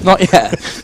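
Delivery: chuckling through it; laughing. Real, not acted. Laughing at Queen joke.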